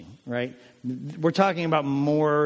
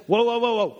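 high, loud alarmed whoas; a shocked disciple throwing up his hands; higher and louder alarmed protest; startled objection; 7 semitones higher, 4 dB louder than usual. Acted. Peter objects to Jesus washing feet.

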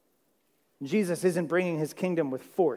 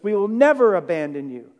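false bravado; 3 semitones higher, 9 dB louder than usual. Retold or imitated. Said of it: "shook their fists and puffed their chests".

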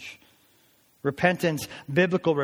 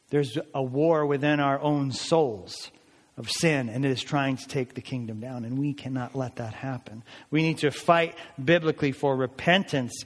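ordinary talk, nothing marked

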